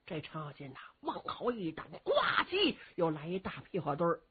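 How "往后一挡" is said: usual speaking voice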